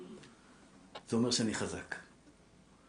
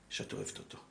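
whispered tenderness; 5 semitones lower, 13 dB quieter than usual. Real, not acted. Tender words about spouse.

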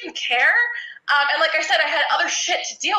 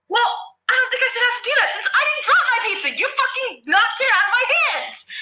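retold argument; 10 semitones higher than usual. Retold arguing with the boy.

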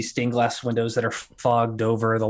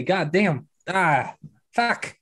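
a touch higher, lightly voiced swearing barkeep; Larry swearing; 6 semitones higher, much slower than usual. Larry amazed at the payment, light swearing. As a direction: mutter the curse in mild awe. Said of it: "I fucking say".

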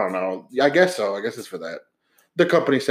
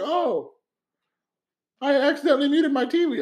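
mock old lady; 12 semitones higher than usual. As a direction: imitate a panicked old lady in a much higher voice. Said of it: "she's like".

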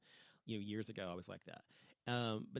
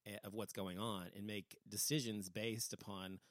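usual speaking voice

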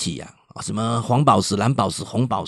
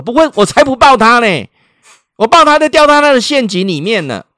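high, loud mock outrage; indignant refusal; 12 semitones higher, 8 dB louder than usual. Mother refuses to hug her crying child, indignant. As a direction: imitate a mother flatly refusing, pitched way up.

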